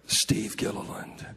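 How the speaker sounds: deep announcer voice, measured; backstage announcer. Retold or imitated. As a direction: imitate an announcer introducing the next speaker. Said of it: "I heard backstage".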